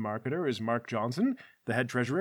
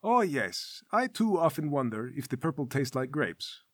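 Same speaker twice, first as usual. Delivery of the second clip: polite, measured wizard with a smile; mildly lofty, smiling politeness; Wayne. Acted. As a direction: muse courteously, quite restrained. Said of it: "The wizard Wayne smiles and nods politely".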